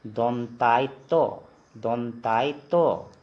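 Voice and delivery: "दंतायतो" slowly, in parts: "dontai-to"; Assamese letter name. Shown as a demonstration. Teacher answering for students, slow syllabled "dontai-to". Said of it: "क्या बोलेंगे".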